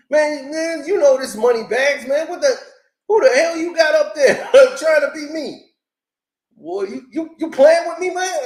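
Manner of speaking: hammed-up furious street rant; mock angry Moneybags. Moneybags yelling at Jay over the radio phone, hammed-up angry. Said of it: "took the phone out of his hand like".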